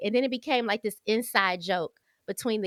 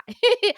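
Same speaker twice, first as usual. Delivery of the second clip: quick high nervous laugh; real short laugh; 11 semitones higher, 7 dB louder than usual. Real, not acted. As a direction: laugh off the worry. Laughing at own confession.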